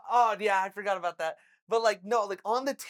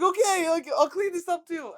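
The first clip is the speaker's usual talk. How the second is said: exasperated outburst; 10 semitones higher, 8 dB louder than usual. Retold or imitated. Exasperated about cleaning again.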